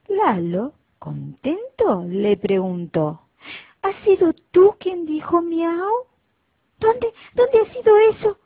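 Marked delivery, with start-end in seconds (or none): 3.8-6.1 cachorrito curioso, agudo y fuerte; voz de cachorrito. Acted. El cachorrito pregunta al ratón. 6.8-8.4 chillido agudo y fuerte de ratón asustado; grítalo como un ratoncito aterrado. Acted. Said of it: "se asustó el ratoncito".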